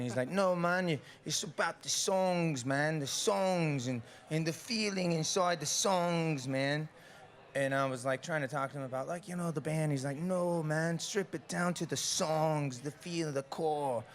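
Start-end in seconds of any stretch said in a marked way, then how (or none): 0.3-6.9 slow, higher British mentor voice; light English accent, drawn out slowly. Retold or imitated. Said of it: "he's this British guy". 10.2-14.0 slow, higher British mentor voice; light English accent, drawn out slowly. Retold or imitated. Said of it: "He's from England".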